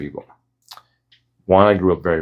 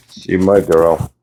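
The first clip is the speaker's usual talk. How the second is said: doting pet talk; 3 semitones lower, 4 dB louder, much slower than usual. Real, not acted. Cooing about her dog.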